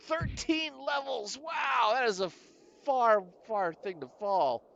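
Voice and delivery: high, drawn-out astonishment; slow, high-pitched wow; amazed exclamation. Real, not acted. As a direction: call out wide-eyed at a huge drop. Amazed at long fall.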